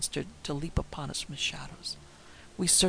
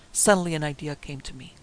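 ordinary delivery, nothing marked

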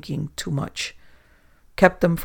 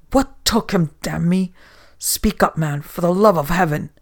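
shrieking; 5 dB louder than usual. Acted. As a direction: shake the answer out of him. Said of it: "shrieking into his very face".

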